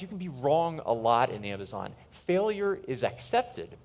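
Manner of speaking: ordinary talk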